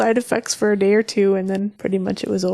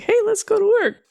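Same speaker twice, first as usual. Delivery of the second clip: bright high chipper voice; perky morning cheer; 13 semitones higher, faster than usual. Retold or imitated. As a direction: pop up cheerful and ready. Bright and ready Monday.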